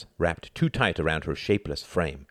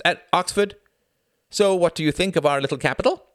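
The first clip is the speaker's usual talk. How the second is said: excited woman's voice; 12 semitones higher, 5 dB louder than usual. A narrator's reading. Greta excited.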